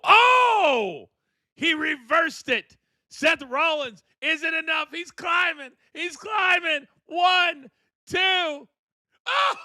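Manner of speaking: high, frantic live call; wrestling commentator. Real, not acted. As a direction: call the climb like a ringside announcer. Calling a live wrestling match.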